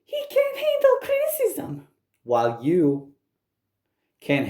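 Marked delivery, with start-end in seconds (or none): 0.0-1.9 very high squeaky mock voice; mocking quote. Quoting a rival's excuse back, mocking high squeak. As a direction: mimic them in a tiny, sky-high voice. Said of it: "You are saying".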